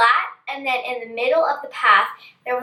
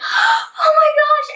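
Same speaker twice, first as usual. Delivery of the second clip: gasp, then high, drawn-out mock cry; happy surprise; 4 dB louder, slower than usual. Girl seeing her dad come out, gasping in relief. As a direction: imitate overwhelmed, tearful relief. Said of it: "I was so happy and I was so surprised".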